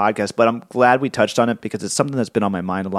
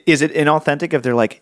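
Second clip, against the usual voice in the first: normal talking voice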